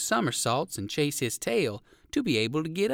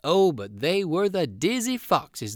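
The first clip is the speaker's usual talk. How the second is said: ordinary delivery, nothing marked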